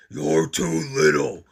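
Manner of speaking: slow, loud big-monster voice; Monty scoffing. A narrator's reading. Monty the biggest monster turning Grover away, slow and loud. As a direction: brush him off as Monty. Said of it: "said Monty, the biggest monster".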